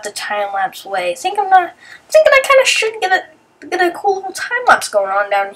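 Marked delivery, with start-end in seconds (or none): none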